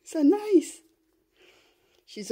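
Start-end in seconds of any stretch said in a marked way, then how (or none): 0.0-0.8 high cooing. Real, not acted. Cooing at puppy.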